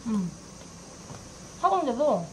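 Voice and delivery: talking between bites; chewing. Real, not acted. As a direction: mouth full of food. Seen 0: Bit chicken at -2s; lips closed, working food through the words.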